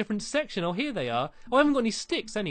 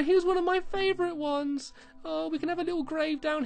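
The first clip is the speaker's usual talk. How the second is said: sad lament; 9 semitones higher than usual. Real, not acted. Mourning dead dog.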